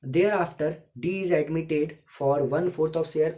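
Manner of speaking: slow, deliberate classroom dictation. Shown as a demonstration. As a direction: dictate it slowly so students can write. Reads question slowly, dictation style.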